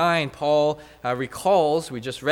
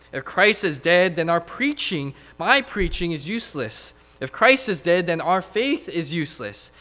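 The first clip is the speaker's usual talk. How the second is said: rising, louder preacher cadence, hammering each if; building preaching; 3 semitones higher, 5 dB louder than usual. Acted. Preacher to his church, building to a loud climax. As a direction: drive each hypothetical home to the congregation.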